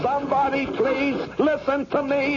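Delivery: desperate plea. Acted. Caged man begs passers-by to hear him, high desperate cry. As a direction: cry out, begging anyone to listen.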